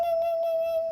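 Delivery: loud, high mock ringtone; imitated phone ring. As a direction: trill "ring" over and over like a phone.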